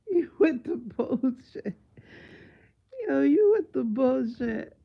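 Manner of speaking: wailing through tears of laughter; laugh-crying. Real, not acted. Vlogger laugh-crying at the doctor's story. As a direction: cry it out while laughing helplessly.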